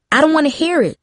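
high, sharp cut-off; snapped. A narrator's reading. Darcy cutting off Prasanna's warning about Brian, snapping. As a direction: shut her down mid-sentence. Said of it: "Darcy snapped".

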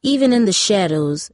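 plain reading voice

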